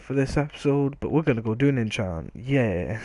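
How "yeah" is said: usual speaking voice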